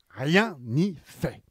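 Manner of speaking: usual speaking voice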